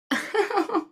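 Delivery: short bright giggle; light laugh. Real, not acted. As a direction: laugh along playfully. After silly face game.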